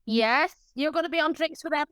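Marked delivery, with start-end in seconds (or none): none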